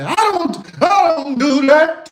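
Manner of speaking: high, slow, prim fake-innocent voice; mock prissy denial. Mocking people who lie about not drinking, high prim voice. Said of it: "Some people like to lie and say".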